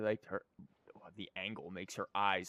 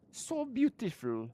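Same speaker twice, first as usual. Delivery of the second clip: gushing; 11 semitones higher than usual. Real, not acted. Gushing over outfit.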